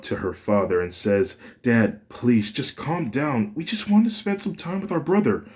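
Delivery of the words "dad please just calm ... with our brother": higher, rising pleading daughter; a hint of a desperate daughter begging calm; Fuyumi pleading. Daughter begs her angry father to calm down, higher and pleading.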